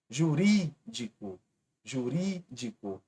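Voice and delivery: "Jurídico" slow, stress on "rí"; stressed syllable shown. Shown as a demonstration. Teacher to exam students, "Jurídico" slow, stress on "rí". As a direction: draw out "Jurídico" and lean on "rí". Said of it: "Outra proparoxítona".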